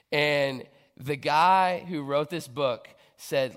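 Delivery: usual speaking voice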